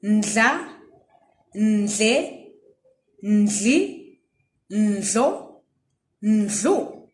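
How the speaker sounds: ndla ndle ndli ndlo ndlu, each rising like a prompt; call-and-response drill; drill syllables. Shown as a demonstration. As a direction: lead the class through the drill. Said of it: "Let's do it again".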